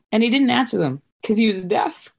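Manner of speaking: ordinary talk, nothing marked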